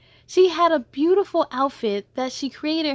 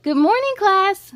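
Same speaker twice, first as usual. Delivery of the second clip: bright, higher and louder good-morning; cheerful teacher greeting; 8 semitones higher, 8 dB louder than usual. Acted. Teacher greeting her class at the start of the day, bright and louder. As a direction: sing it out like a friendly teacher.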